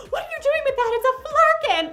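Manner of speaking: high, loud panicked cry; freaking out. Retold or imitated. Ben Mendelsohn's character freaking out at the cat, panicked. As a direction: shriek a warning in alarm. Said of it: "freaks out at the cat and says".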